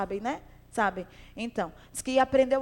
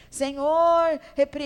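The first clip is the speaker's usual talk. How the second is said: louder imitated prayer, cut off mid-word; loud mock prayer; 2 semitones higher, 7 dB louder, much slower than usual. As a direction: ham up the rebuking prayer louder, then break off. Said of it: "esses dias eu vi alguém orando".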